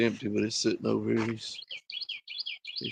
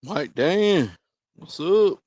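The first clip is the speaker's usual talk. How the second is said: a high, loud, cheerful hello; excited greeting; 6 semitones higher, 5 dB louder, much slower than usual. Real, not acted. Greeting the dog Mike Dan, a high, loud hello.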